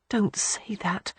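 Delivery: a slow pleading whisper; whispered plea. A narrator's reading. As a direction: hush him, begging softly. She pleads, frightened for him.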